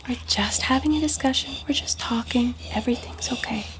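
hushed, soothing whisper. Acted. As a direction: whisper to calm a frightened dog. Whispering to calm dog.

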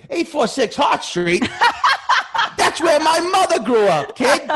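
high, loud, laughing cabbie voice; jolly old New York cabbie. Retold or imitated. Friendly cabbie greeting his passenger, high and laughing. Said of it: "expected him to just be like".

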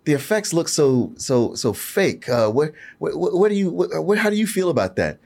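high, stammering audience question; imitating fumbling questioner. Acts out hesitant questioner at Q&A.

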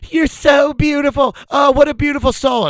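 loud, very high falsetto cooing; gushing mom. Retold or imitated. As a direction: gush in a shrieky high mock-mom voice. Acting moms gushing in his ear.

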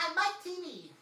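high, loud cartoon shout; shouty kid character. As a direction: bellow it like a bratty kid. Voicing movie character.

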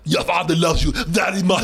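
mock-sincere line in a thick Schwarzenegger accent. Host as the disguised dad comforting his son, Schwarzenegger accent. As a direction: ham up the heavy Schwarzenegger accent. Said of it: "that heavy ass accent".

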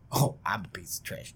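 hissed whisper; mock whisper. Prince answers the insult, mock whispered. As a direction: breathe it out sarcastically. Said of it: "And he goes".

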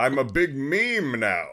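Patrick Stewart parody. Acted. Patrick Stewart reduced to cartoon cameos, slow hammed-up self-parody. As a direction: draw it out like a grand thespian spoofing himself. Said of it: "like, [name] Patrick Stewart".

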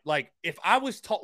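ordinary talk

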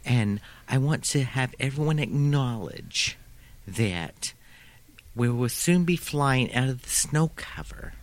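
put-on slow flight attendant with New York accent; drawn-out, lisping New York accent; thickest New York accent. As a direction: read safety instructions in a heavy New York accent. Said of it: "New York accent I've ever heard".

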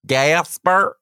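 "Gasper" up high, rising; mock mispronunciation. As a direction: tease him with the wrong "Gasper". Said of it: "mocking the name".

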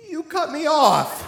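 high, slow, near-tears you cut me off; choked repeat. Retold or imitated. Repeating his complaint to the stranger, high and tearful. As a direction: push the words out through welling tears.

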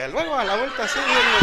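high, laughing, dismissive "luego"; mock brush-off. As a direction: pay back the snub lightly. Él devuelve el rechazo. (rechazo burlón de revancha, agudo)

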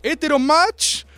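high, camp mocking voice, slowed at the start; mocking own past self. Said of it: "yo decía".

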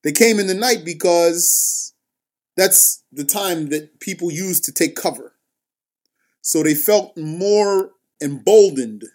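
ordinary talk, nothing marked

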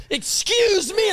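outraged mock shriek; indignant shout. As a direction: snap it out like an offended old lady. Old woman snaps at him.

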